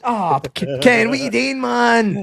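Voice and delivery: high, slow mock despair at Ken; mock exasperation. Mocking Ken's naming of Fraser, high and exasperated. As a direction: pitch way up, whining at Ken.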